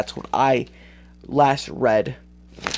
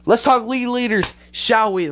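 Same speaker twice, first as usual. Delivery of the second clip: bright host segue; 10 semitones higher, 4 dB louder than usual. Real, not acted. Host announcing new segment.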